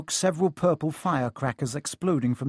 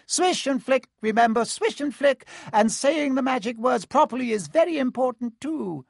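high squeaky little professor; squeaky Flitwick; 10 semitones higher, 4 dB louder than usual. Acted. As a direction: squeak it out, tiny and eager. Flitwick continues teaching.

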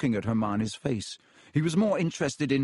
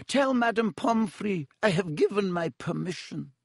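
tearful; 6 semitones higher than usual. Acted. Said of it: "a tear glistening in her beady eye".

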